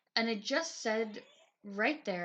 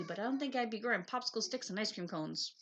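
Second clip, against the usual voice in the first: usual speaking voice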